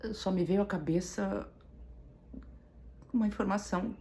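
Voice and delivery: high, strained voice with long pauses; really fighting back tears, halting between phrases; choked with grief. Real, not acted. Woman recalling finding his name in the obituary, choked with grief.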